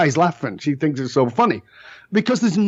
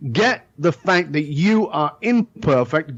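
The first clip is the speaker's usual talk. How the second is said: ordinary talk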